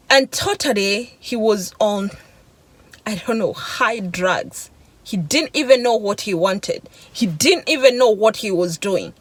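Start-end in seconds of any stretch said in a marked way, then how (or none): none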